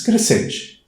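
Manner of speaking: high leap on the word; rising voice demo. Shown as a demonstration. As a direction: lift "crescente" way up to show the rise. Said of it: "Se a semivogal vem antes".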